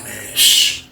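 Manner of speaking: whispered shushing; hushed shh. Retold or imitated. Telling the cellmate to keep quiet, whispered. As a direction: shush him softly. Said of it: "I was like".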